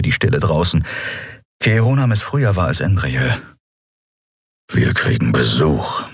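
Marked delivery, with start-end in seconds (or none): 4.7-6.0 deep growled warning; low growl. A narrator's reading. Kero warning Endriel of approaching ships, deep growl. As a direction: growl it low, sensing danger. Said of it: "knurrte er".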